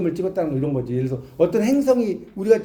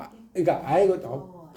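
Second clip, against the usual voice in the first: normal talking voice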